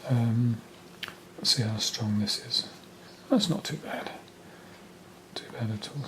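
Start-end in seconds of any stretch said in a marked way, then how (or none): 3.3-6.1 whispering. Real, not acted. Painter muttering to himself.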